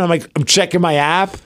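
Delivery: ordinary talk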